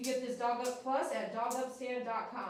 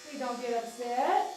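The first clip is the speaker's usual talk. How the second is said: sing-song plea. Real, not acted. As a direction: coax the dog with a repeated sing-song plea. Soothing the dog with chanted plea.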